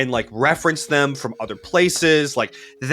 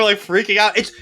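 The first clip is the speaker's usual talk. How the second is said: normal talking voice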